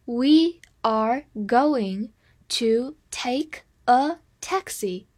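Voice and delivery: very slow, each word set apart; slow reading. Shown as a demonstration. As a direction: read word by word with clear pauses. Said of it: "我们慢读一遍". (逐词停顿地慢读)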